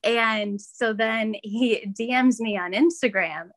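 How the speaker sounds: ordinary talk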